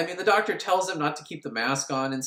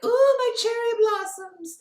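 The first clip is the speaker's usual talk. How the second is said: very high, slow falsetto; put-on high feminine coo; mock woman's voice; slower than usual. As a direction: squeal it slowly, hammed-up ecstasy. Voicing the woman during sex.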